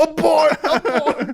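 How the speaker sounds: high, loud abort alarm; hammed-up panicked call to bail out; mock panic. Bailing out of his fake backstory, loud mock panic.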